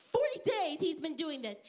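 normal talking voice

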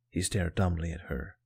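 plain reading voice

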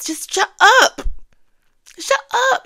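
high, loud, fed-up yell; shrieking at Jussie to stop talking; exasperated shut up. Real, not acted. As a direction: tell him off at full pitch. Ranting at Jussie Smollett.

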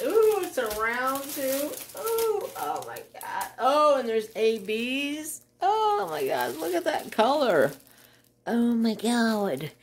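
high-pitched, gushing excitement; delighted awe. Real, not acted. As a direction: ooh and aah over it, pitched high. Marveling at the new colors.

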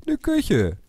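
squeaky high repeat of "Eine Küche". Pretend. Repeats it much higher.

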